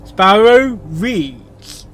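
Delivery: slow, announcer-like drawl; drawn-out title read. Acted. Channel title card, read slow. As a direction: stretch each word out. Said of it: "Sparrow Presents".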